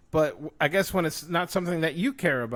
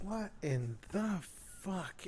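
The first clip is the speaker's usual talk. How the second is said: slow, hushed, word by word; stunned disbelief; 14 dB quieter, slower than usual. Real, not acted. Fan still appalled at the murder, hushed disbelief. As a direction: say it softly, dragged out in dismay. Same curse repeated in dismay.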